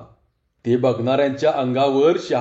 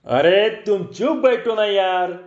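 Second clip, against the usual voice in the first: higher, exasperated plea without shouting; almost snapping; 4 semitones higher than usual. A narrator's reading. Magician to Babu who keeps interrupting, nearly snapping. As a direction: snap mildly, voice pitched up. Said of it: "जादूगार जवळजवळ खेकसलाच".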